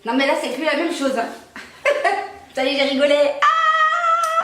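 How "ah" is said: high yelp after a slap. Real, not acted. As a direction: cry out at the slap. Slapped after laughing.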